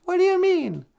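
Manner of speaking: very high squeaky question; a tiny puppet asking. Acted. The sidekick squeaks a question at the host.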